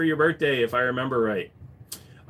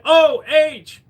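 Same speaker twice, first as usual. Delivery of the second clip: high, loud cheer; rallying shout; 13 semitones higher, 8 dB louder than usual. Real, not acted. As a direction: call it out like a stadium chant. Calls out an Ohio cheer.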